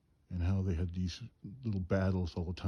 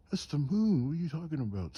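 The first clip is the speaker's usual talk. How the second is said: high, loud mock disbelief; incredulous objection; 9 semitones higher, 4 dB louder than usual. Woken sleeper to uncle at the moonlit sky, incredulous retort. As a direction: protest as if they've lost their minds.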